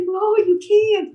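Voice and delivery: high, loud and slow refusal; firm no. Retold or imitated. Clown refusing the kid who sneaked in, loud slow no. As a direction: lay down the law to a child.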